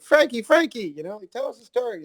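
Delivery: put-on high-pitched child voices; kids' voices. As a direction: call out like eager kids. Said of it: "the kids are like".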